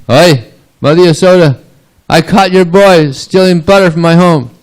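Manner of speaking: high, loud acted complaint; indignant calling out. Gopi calling at Mother Yasoda's door, indignant shout. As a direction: holler it as an outraged neighbour.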